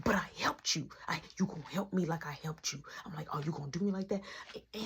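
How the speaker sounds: very soft, half-whispered dialogue; whisper-argue so Mom stays asleep; retold sneaky back-and-forth under the breath; hushed secret talk. Retold secret late-night talk with brother.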